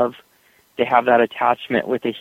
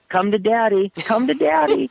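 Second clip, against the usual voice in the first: high cooing to the dogs; 10 semitones higher than usual. Retold or imitated. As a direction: coo like a doting dog dad. Friend cooing at his dogs.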